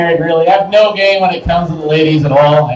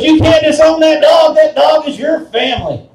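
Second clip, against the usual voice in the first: indignant wife; 8 semitones higher than usual. Retold or imitated. Wife defending the dog to her husband, high and indignant. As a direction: scold him like an appalled wife. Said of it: "She goes".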